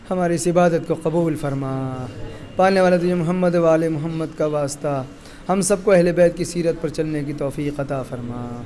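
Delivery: soft, low closing supplication; quiet prayer. Acted. Preacher closes the gathering with a soft, low prayer. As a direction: pray softly after the lament.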